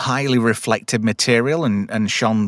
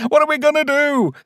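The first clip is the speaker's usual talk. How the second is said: panicked whine. Acted. Voicing scared character in recap.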